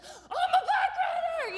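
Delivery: put-on very high, strained child's shout; wild celebrating. Daughter shouts her affirmation to Mom, wildly pumped. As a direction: squeal it out in mock triumph. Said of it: "celebrating like psychopaths".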